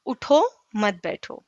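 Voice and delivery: اٹھو set apart, then مت بیٹھو as one unit; pause after اٹھو. Shown as a demonstration. Teacher shows a small example, pause after اٹھو. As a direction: stop briefly after اٹھو. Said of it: "چھوٹی سی مثال کو دیکھیے".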